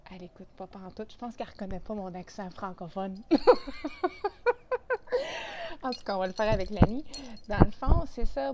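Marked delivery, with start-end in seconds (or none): 3.2-5.8 loud high laugh, caught off guard; high burst of laughter. Real, not acted. Vlogger laughing at her dog, high loud laugh. 5.8-8.5 still laughing. Real, not acted. Laughing it off with dog.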